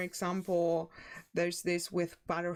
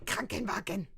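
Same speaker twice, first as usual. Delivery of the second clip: mock angry. Shown as a demonstration. Mimicking what foreigners think Germans sound like, mock angry. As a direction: spit it out in fake fury. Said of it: "no one talks like this".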